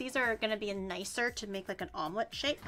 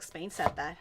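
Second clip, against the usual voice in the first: deadpan mock chat; 7 semitones lower, 5 dB quieter than usual. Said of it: "chat's like".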